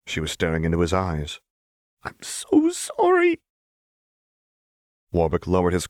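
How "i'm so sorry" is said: high, breathy, slowed whisper; tearful whisper. Acted. Jinx whispers through tears to Warwick.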